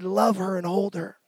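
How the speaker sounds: soft, low, tender repetition; tender divine voice. Retold or imitated. God telling the son to love his raging mother, soft and tender. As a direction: speak it gently like a calm command of love. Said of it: "God just said".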